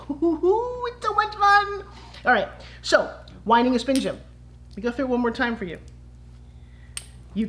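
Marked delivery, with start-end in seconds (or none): 0.1-1.8 high, drawn-out singsong squeal of fun; giddy glee. Pretend. Coach inviting trainees on her journey, giddy. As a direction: hoot it high and stretch it out.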